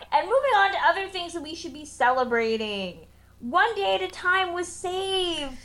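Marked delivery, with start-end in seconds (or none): 3.4-5.6 high, drawn-out happy news; celebratory announcement. Real, not acted. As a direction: announce it like a victory, stretching it out. Announcing good news to cheers.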